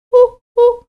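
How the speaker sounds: high hooting owl voice. Acted. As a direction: hoot it like a wise owl.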